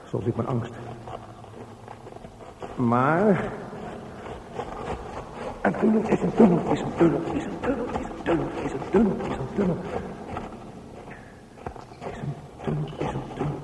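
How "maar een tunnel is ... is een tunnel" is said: higher pitched looping mantra that fades away; obsessive repetition. Acted. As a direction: chant it over and over to keep calm. Repeating words like a mantra.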